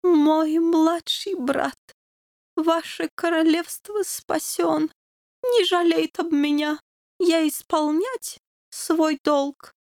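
put-on slow, high, foreign-accented dying king; dying dog king. A narrator's reading. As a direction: speak slowly with the last of his strength. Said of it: "говорил тихо-тихо".

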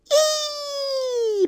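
one long, extremely high thin squeal; drawn-out piping squeak; tiny mouse squeak. A narrator's reading. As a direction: hold the vowel as a baby mouse squeak. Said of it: "пропищала совсем крошечная мышка".

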